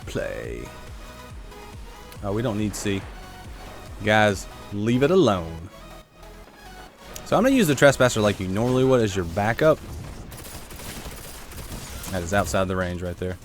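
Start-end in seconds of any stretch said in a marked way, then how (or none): none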